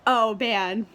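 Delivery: ordinary delivery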